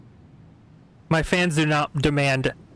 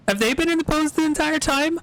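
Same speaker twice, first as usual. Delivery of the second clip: much higher, incredulous rising question; real astonished repeat; amazed disbelief; 12 semitones higher, faster than usual. Real, not acted. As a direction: ask it again in utter disbelief.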